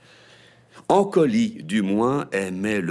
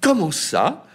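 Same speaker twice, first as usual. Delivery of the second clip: mother asking; 11 semitones higher, 7 dB louder, slower than usual. A narrator's reading. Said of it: "demanda Azalée".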